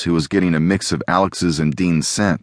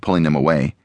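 usual speaking voice

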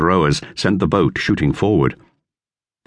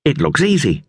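higher, lightly voiced boy; boy's voice, lifted higher; Dick; 9 semitones higher than usual. A narrator's reading. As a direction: read it as young Dick. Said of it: "said Dick".

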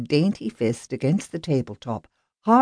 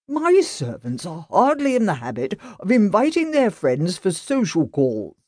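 lightly voiced, irritated householder; indignant rich master of the house; haughty gentleman; 6 semitones higher than usual. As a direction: brush off the policeman with disdain. Grant replying.

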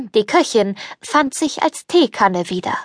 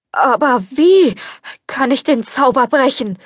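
higher, despairing question; despondent; 4 semitones higher than usual. Acted. The beast asks the fairy despairingly. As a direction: ask helplessly, without hope. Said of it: "fragte das Biest verzagt".

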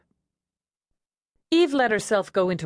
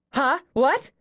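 higher, startled and flustered; startled panic; 7 semitones higher than usual. A narrator's reading. Eve jolted from her trance by birth news, startled. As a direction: blurt it in a fluster.

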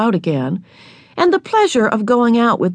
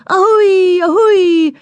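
high, loud wail with great feeling; wailing train whistle; 12 semitones higher, 6 dB louder, slower than usual. A narrator's reading. Little girl wails like a train with great feeling. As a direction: wail like a locomotive, full out. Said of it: "and wailed".

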